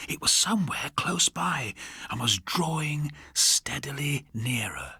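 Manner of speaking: slow, whispered ghost-story telling; hushed suspense narration. A narrator's reading. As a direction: lean in and breathe the scary part slowly. Suspense passage read hushed.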